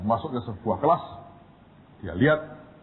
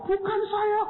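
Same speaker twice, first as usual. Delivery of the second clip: high whimpering plea; tearful denial. Retold or imitated. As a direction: deny it almost in tears.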